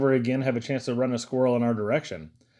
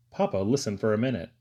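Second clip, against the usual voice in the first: usual speaking voice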